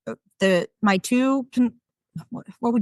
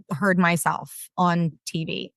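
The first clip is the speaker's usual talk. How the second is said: normal talking voice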